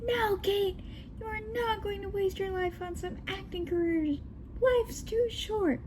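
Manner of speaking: high-pitched, soft mock yell; mock yelling dad. Dad forbids his daughter's acting career in a high mock yell. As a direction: put on an upset dad without real volume. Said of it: "My dad yelled".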